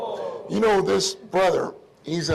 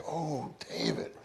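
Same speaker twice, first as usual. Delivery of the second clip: "Oh, David" said softer and slower, "Oh" drawn out; earnest longing; 3 semitones lower, 9 dB quieter, slower than usual. Shown as a demonstration. Preacher showing how earnestly longing for someone sounds, soft and slow. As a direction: breathe "Oh, David" as if you truly long for him. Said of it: "that means I earnestly want his presence".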